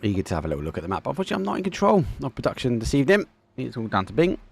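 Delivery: brisk esports commentary, lively and running; live play-by-play. Acted. Caster narrating the final game to viewers, lively play-by-play. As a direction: call the action for the stream.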